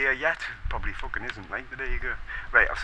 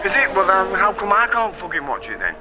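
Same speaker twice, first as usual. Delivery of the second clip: ordinary talk, nothing marked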